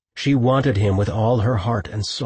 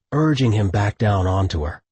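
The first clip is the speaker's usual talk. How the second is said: usual speaking voice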